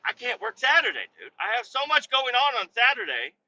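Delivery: high-pitched whiny excuse; retold desperate begging; acted pleading. Retold begging to his boss.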